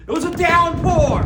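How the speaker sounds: loud, high dramatic announcement; excited yell. Acted. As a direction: shout it like the storm breaks. Storm climax in the story.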